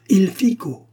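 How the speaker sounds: sudden high, loud cry of discovery; exclaiming. A narrator's reading. Eustache suddenly grasping the word's meaning, exclaiming. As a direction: burst out as the meaning clicks. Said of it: "s'écria-t-il en se levant".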